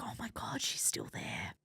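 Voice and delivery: soft unvoiced whisper of disbelief. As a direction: breathe it out in a hushed whisper. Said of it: "you'd kinda go like".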